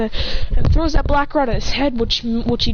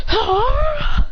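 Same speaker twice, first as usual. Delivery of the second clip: high play-fight yell; throwing yell. Acted. Cry while throwing a shuriken.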